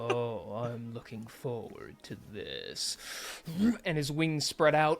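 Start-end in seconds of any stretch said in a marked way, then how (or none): 0.1-3.0 suave villain. Acted. Dragon villain taunt.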